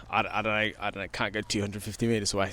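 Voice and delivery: normal talking voice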